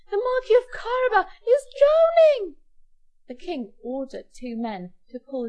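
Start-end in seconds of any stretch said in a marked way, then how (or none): 0.1-2.6 high, loud cry of alarm; acted panicked announcement; urgent yell. A narrator's reading. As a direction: shout the emergency out to everyone. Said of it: "yelled Puss".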